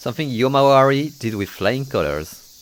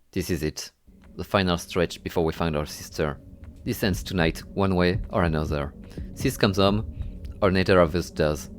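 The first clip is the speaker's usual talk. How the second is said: low, hushed trailer-style narration; dramatic narration; 4 semitones lower, 5 dB quieter than usual. Acted. Narrator opens the final chapter, hushed and grave.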